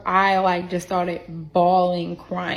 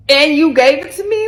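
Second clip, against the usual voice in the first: higher, louder disbelieving question; incredulous accusation; 8 semitones higher, 9 dB louder than usual. Retold or imitated. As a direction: throw it at him in outraged disbelief. Imagining confronting her ex.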